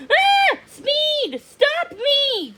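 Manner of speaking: very high, frantic pleading; panicked plea. A narrator's reading. Frog swinging over gators begging the turtle, high panicked plea. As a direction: beg your friend to stop you. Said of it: "John Bob pleaded".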